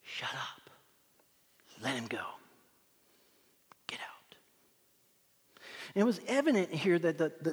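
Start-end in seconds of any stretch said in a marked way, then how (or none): 0.0-4.4 low whispered commands with long pauses; hushed, deliberate orders, very soft; whispered authority. Acted. King taking authority over demons, whispered commands. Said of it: "begin to take authority".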